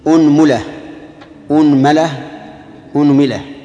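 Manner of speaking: أنملة with damma on the hamza, then the meem in three vowels; unmula with u. Shown as a demonstration. Teacher drilling students in the damma forms of أنملة, pronunciation demo. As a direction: say أنملة as unmula, unmala, unmila. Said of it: "خذوا ضم الهمزة وتثليث الميم".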